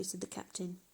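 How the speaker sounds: plain reading voice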